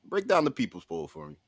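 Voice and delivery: ordinary talk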